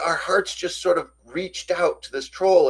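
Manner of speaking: ordinary talk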